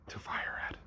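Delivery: whisper. Real, not acted. Joking aside after a reading slip.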